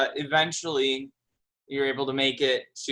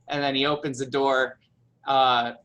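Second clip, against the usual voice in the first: usual speaking voice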